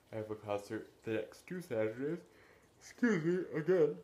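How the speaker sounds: yawn-stretched, rising voice; talking through a yawn. Real, not acted. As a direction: yawn creeping in, pitch climbing high. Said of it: "I don't know why I am yawning".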